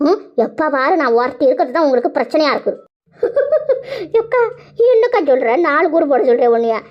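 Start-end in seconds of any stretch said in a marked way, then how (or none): 3.2-4.1 high falling burst of laughter. Acted. A high laugh at the mother's complaint.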